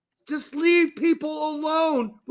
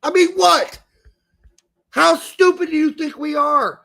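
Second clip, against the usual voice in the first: louder, higher, fed-up disbelief; indignant raised voice; exasperated outburst; 3 semitones higher, 6 dB louder than usual. Real, not acted. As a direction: snap back at the insult to your intelligence. Ranting at marketers.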